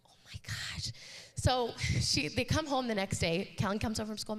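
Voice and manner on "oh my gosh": a breathless, voiceless whisper of disbelief; stunned whisper. Retold or imitated. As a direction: whisper it like you can't believe it. Mother stunned by daughter's plan.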